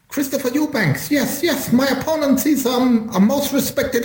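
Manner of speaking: high, lisping boxer voice; Chris Eubank impression. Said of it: "Or maybe Chris Eubank?".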